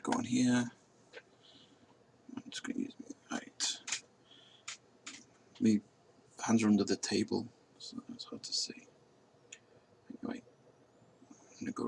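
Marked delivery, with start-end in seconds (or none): 2.4-3.8 whisper. Real, not acted. Muttering while starting the drive. 7.8-11.9 whisper. Real, not acted. Aside about hidden hands.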